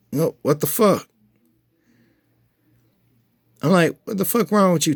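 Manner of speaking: stunned at the bowl flying; shocked outburst. Retold or imitated. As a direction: blurt it out in disbelief. Shrimp bowl thrown on floor.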